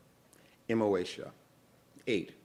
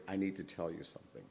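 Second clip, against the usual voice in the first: hushed aside; 3 semitones lower, 6 dB quieter, faster than usual. A narrator's reading. Teller lowers voice to confide.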